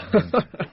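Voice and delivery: light teasing giggle; teasing laugh. Acted. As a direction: laugh at his expense. Teasing her fiancé Brian.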